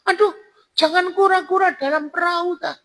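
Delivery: very high falsetto woman; high put-on female voice; woman's voice. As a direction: pitch way up as the woman answering. Voicing the woman in the story.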